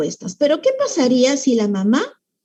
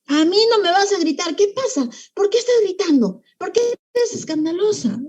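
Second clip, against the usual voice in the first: higher-pitched scolding, not truly shouted; acted string of indignant questions; stressed, out-of-control mom; 7 semitones higher than usual. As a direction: tell off a noisy child in exasperation. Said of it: "también estresar, descontrolar y decir".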